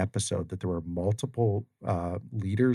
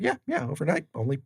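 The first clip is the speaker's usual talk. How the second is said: higher-pitched mock agreement; wry agreement; 7 semitones higher than usual. Joking back to callers about overnight success, wry agreement. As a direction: play along lightly, pitched up.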